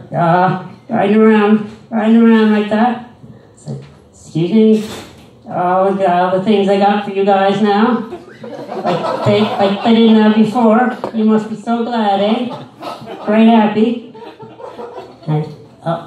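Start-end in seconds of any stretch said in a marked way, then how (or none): 0.1-3.1 put-on high, loud condescending old lady; mocking a patronizing old lady at the mall. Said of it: "old lady voice continues". 4.1-14.2 put-on high, loud condescending old lady; mocking a patronizing old lady at the mall. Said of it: "old lady voice continues".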